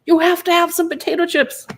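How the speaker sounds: high, imitated urging; mock insistence. Doctors pressing potato chips on the mother, mock insistence.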